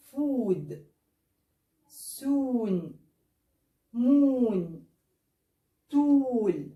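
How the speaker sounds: each word said with a long oo vowel. Shown as a demonstration. Teacher modelling long o words for students. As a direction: draw out the vowel in every word. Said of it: "تعالوا ناخد الامثله دي معانا".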